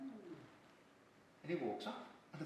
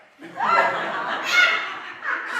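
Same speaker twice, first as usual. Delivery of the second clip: loud high laugh; high unvoiced cackle; cackling laugh; 15 dB louder than usual. Real, not acted. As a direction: burst out laughing. Laughs at own joke.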